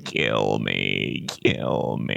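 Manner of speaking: very deep, slow, groaning gut voice; low rumbling belly voice begging for death; talking stomach. Pretend. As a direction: let the stomach moan from deep down. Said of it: "your stomach's like".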